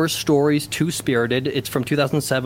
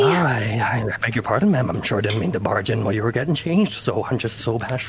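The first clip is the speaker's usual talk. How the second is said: put-on low, bashful Irish accent; shy Irish bloke; 6 semitones lower than usual. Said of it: "stick with the shy Irish bloke".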